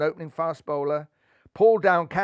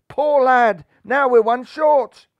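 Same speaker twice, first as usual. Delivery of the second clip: dismayed; 9 semitones higher, 12 dB louder, slower than usual. A narrator's reading. Dismayed supporter.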